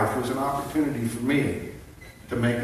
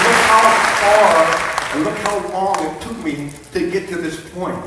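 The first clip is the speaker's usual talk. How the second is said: high, loud and fervent; impassioned testimony; 7 semitones higher, 9 dB louder than usual. Real, not acted. Speaker tells the crowd how long his journey took, impassioned. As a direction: call it out over the applause.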